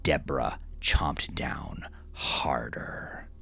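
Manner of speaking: ordinary delivery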